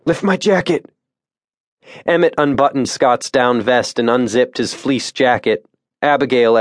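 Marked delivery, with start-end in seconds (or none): 0.0-0.9 wounded man. A narrator's reading. Wounded guide pleading.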